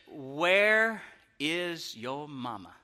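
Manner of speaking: put-on slow, deliberate confrontation of a child; slow, drawn-out demand. Dad confronting the boy who pushed his son, slow and drawn-out. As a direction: stretch every word out slowly.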